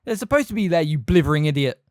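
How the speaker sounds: loud, scornful telling-off; shouting. A narrator's reading. McGonagall yells at the caretaker, loud and scornful. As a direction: blast him for being stupid. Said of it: "shouted McGonagall".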